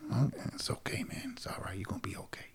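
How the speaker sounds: hushed, very soft comforting whisper; whispered reassurance. Retold or imitated. Coach hugging an emotional grown man, whispering reassurance. Re-enacts comforting hug of client.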